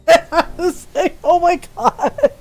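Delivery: high, slow words through laughter; laughing. Real, not acted. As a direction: barely get it out for laughing. Cracking up over the cat's nickname.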